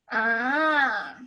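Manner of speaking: long "Ah" with a turning point in its pitch; third tone. Shown as a demonstration. Teacher moves body to show the tone, long bending "Ah". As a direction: stretch "Ah" and bend it like a wave. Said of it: "So it goes down and then up".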